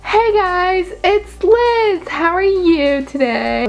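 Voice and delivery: high, exaggerated hello to viewers; put-on vlogger greeting. Puts on a video greeting.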